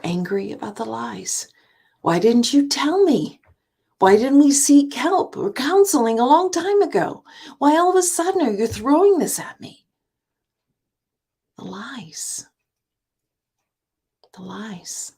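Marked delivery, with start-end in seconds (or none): 2.0-9.9 higher and louder, demanding answers; hurt outburst. Acted. Betrayed spouse confronting partner, rising hurt outburst. 11.6-15.1 softer, barely voiced; hushed. Speaker to divorce listeners, hushed emphasis. Hushed repetition after outburst.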